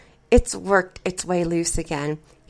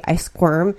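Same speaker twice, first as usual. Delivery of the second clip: ordinary delivery, nothing marked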